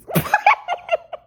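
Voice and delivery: high, loud real laugh. Real, not acted. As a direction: burst out laughing. Laughs at roaches line.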